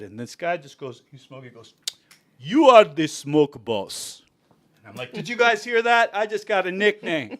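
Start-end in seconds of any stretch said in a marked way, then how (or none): 2.4-4.2 put-on slow, heavy Czech accent, each word weighed. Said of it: "a Czech accent". 5.1-7.4 delighted. Retold or imitated. Delighted at new nickname.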